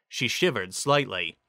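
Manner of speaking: ordinary delivery, nothing marked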